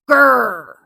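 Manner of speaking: loud grumpy growl; angry growl. A narrator's reading. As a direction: growl like a sour grape. Became a sour grape.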